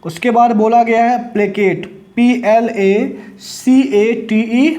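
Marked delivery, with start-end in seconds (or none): 2.2-4.7 spelled out slowly. Shown as a demonstration. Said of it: "spelling out the word".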